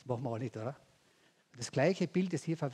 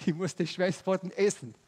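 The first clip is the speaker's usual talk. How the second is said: laughing; 4 semitones higher, 5 dB louder than usual. Acted. Laughing food joke.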